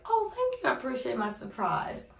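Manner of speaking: very high oh, then a gentle lifted thanks; humouring a small child with a high gushing thanks; sweet voice for a kid. Retold or imitated. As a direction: coo it at a little boy.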